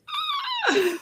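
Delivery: shrieking high laugh of delight; high whooping laugh. Real, not acted. Host remembering her twenties, high whooping laugh.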